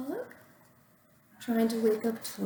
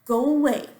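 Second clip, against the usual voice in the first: grumpy go away; 4 semitones higher, 8 dB louder than usual. Acted. Grumpy Toad from bed.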